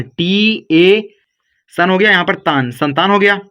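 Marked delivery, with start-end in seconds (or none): 0.0-1.1 slow spelled letters. Shown as a demonstration.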